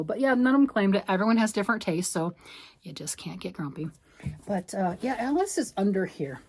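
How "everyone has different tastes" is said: usual speaking voice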